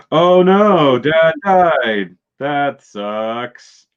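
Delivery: high, loud, hammed-up fake sorrow fading to flat; mock grief. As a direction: pretend to mourn and not care. Mock mourning of the father.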